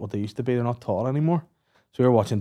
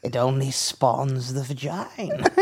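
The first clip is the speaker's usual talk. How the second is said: mock witch, laughing; 3 semitones higher than usual.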